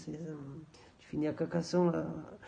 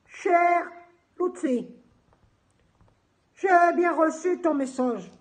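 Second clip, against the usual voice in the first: slow, high, loud put-on formality; mock-solemn letter; 5 semitones higher, 4 dB louder, slower than usual. Answering Lutzi's message as a joke, mock-solemn. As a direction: declaim it slowly, as a joke warning. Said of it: "Non, je déconne".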